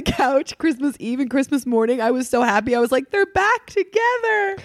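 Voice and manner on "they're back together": put-on high, slow, giddy kid voice; childlike delight. Little kid thrilled parents reunited, high giddy voice. Child's happy Christmas thought.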